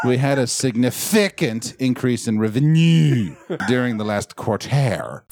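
wrong-syllable stress on "significant", "revenue" and "quarter"; bizarre emphasis. Shown as a demonstration. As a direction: stretch FIC in "significant", NUE in "revenue", TER in "quarter". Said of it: "stick the emphasis in a really bizarre spot".

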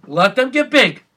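high, loud pleading cry; pleading yell. Retold or imitated. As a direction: beg the screen to spare them. Begging show not to kill dragons.

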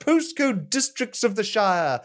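high ringing crier proclamation; town crier. Acted. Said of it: "reenact it with you two".

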